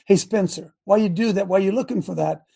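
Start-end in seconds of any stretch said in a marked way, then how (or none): none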